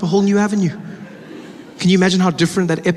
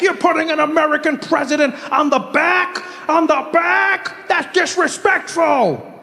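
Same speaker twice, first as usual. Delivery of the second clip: high, slow mock shouting; indignant people; 11 semitones higher, slower than usual. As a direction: stretch out the offended yell. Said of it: "People are like".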